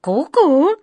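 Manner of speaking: high, loud, rising cuckoo call; pleased cuckoo. Acted. Cuckoo agreeing once the secret is guessed, pleased. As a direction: chime back like a contented bird. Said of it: "с довольным видом посмотрела на него кукушка".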